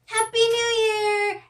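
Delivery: high, slow, loud party cheer; excited cheer. Shown as a demonstration. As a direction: shout it out like it's midnight. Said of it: "Like this".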